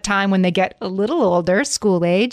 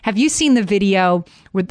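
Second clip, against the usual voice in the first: usual speaking voice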